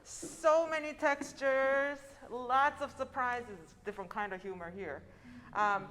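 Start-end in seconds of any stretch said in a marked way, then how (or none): none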